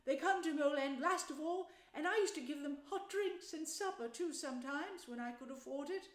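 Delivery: mildly pleased, reminiscent storytelling voice; touch of pride. A narrator's reading. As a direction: let a little pride into the mole's recollection. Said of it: "with a touch of pride in his manner".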